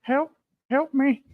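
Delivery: put-on helpless high voice; mock plea for help. Playing a player begging for help.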